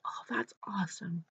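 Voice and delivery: hushed breathy whisper, barely voiced. Nurse whispering to the boy about his lamp, hushed. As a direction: whisper it softly, as if not to disturb.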